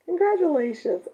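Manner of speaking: Congratulations lifted high and bright. Real, not acted.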